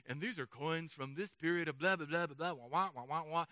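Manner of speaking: low, droning mock tour narration; droning audio guide. Museum audio guide talking at tourists, mock drone. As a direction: imitate a boring guide dissolving into blah and wah. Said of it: "go through this audio guide".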